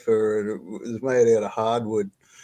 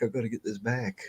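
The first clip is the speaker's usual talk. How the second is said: much softer, trailing mutter; muttered to himself; 2 semitones lower, 10 dB quieter than usual. Retold or imitated. The frustrated old man muttering over the keyboard, much softer. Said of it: "glommed onto it and go".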